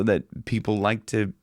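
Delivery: ordinary talk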